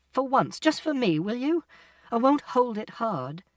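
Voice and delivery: high, imploring mother; pleading. A narrator's reading. Mother asking her son for his hand, pleading.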